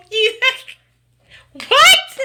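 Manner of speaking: very high, loud disbelieving shriek; shrieked what. Real, not acted. Streamer shrieking at a viewer's joke, high and loud. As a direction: squeal it in total disbelief. Stunned by chat comment.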